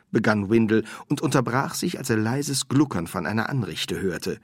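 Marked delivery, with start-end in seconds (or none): none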